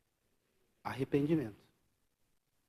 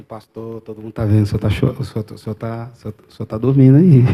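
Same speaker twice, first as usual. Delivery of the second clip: alerta fingido; 4 semitones lower, 13 dB louder than usual. Retold or imitated. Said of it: "Alguém tinha que falar assim".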